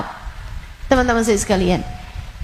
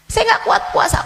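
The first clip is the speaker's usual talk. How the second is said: pitched-up protesting whine; high whiny complaint; 12 semitones higher, faster than usual. Acted. A congregant to the ustazah, high and whining. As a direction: cry it out high like a tired child.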